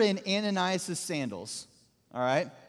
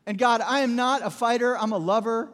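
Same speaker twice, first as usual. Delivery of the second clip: loud, higher, hammed-up objection; comic protest; 4 semitones higher, 6 dB louder than usual. Ananias begs off meeting a murderer, in loud comic protest. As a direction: plead your unfitness with comic insistence.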